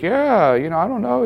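lightly imitating a son's offhand mumble. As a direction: shrug it off like a young boy. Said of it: "he was like".

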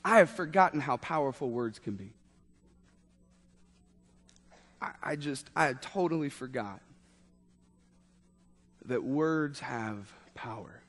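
low, hushed confession; sober reflection. Real, not acted. Preacher admitting he forgot words' power, hushed. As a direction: drop right down soft and let it land. Sober turn after funny story.

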